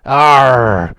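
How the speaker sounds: drawn-out pirate arr; pirate growl. Acted. Pirate exclamation.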